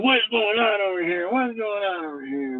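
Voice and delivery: lightly voiced drawn-out cop questions; cops questioning. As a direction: stretch it out like a patrolling cop, lightly mocked. Retold cops walk in, questioning.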